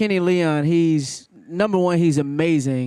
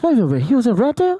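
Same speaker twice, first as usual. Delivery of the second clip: high, loud, fast double take; mock disbelief; 7 semitones higher, 5 dB louder, much faster than usual. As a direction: imitate stunned people finding out. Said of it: "they was like this".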